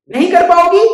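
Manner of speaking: high, loud huffy bird; indignant surprise. Acted.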